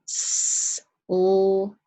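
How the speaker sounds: "S-L-O-P" said as separate sounds; sounding out slope. Shown as a demonstration. Teacher sounds out the word for the class. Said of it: "Let's sound it out".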